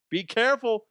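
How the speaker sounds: high, loud be careful; loud high warning. Shown as a demonstration. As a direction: call it out as a warning. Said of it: "repeated warning".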